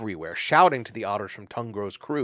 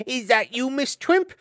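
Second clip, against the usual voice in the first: squeaky little hedgehog; 6 dB louder than usual. Acted. High squeaky Chug.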